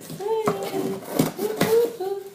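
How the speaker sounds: high, loud whooping cheer; excited cheer. Retold or imitated. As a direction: cheer it like a kid with a new toy. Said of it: "And I was like".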